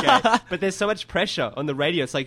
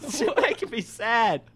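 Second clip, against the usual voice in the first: high-pitched mock-hurt complaint; playfully upset, pitched up high; mock sadness; 7 semitones higher, slower than usual. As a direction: whine in mock hurt, half laughing. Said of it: "I feel sad".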